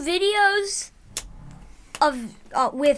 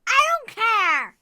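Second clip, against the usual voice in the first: loud, high protest; indignant outburst; 8 semitones higher, 6 dB louder than usual. Real, not acted. Child protesting the trade-in.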